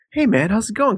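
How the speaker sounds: bright, upbeat greeting; chirpy pizza guy hello; cheerful delivery guy. Retold or imitated. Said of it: "he'd come into the door and be like".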